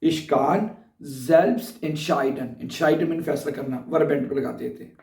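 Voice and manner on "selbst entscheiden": very slow model phrase. Shown as a demonstration. Teacher dictates the verb phrase to students, very slow. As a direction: draw out each syllable of selbst entscheiden. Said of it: "तो कैसे कहेंगे?".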